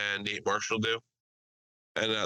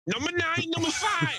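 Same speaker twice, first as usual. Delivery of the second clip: mock yelling. Pho shop owner yelling orders at her daughter, high. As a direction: call the numbers like a restaurant owner. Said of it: "yelling at her".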